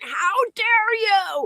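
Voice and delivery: high, slow mock-outraged "how dare you?"; a hammed-up offended lady; outraged woman. Imitating the woman in the Hall of Presidents, mock-outraged. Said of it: "this woman jumped out of her seat and she went".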